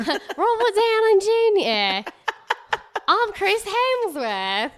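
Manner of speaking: high, slow mock Australian accent; long, stretched Australian vowels; Chris Hemsworth. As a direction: introduce yourself in hammed-up Australian. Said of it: "it's 'cause of his accent".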